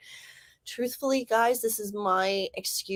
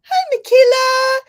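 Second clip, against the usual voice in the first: excited greeting; 13 semitones higher, 11 dB louder than usual. Real, not acted. Host welcomes Michaela as she comes in, excited squeal. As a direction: call out excitedly to a friend arriving. Calling greeting to arriving viewer.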